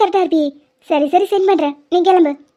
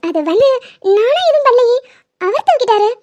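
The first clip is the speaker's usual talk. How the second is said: shy defensive excuse; 5 semitones higher than usual. Acted.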